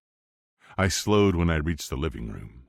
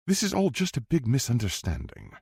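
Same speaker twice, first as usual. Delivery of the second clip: smooth posh English; 6 semitones higher than usual. A narrator's reading. Edward smoothing things over.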